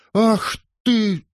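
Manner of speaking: high, loud cry of pain and outrage; pained outcry. Acted. Wife, struck by her husband, cries out in pain. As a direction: cry out as the blow lands.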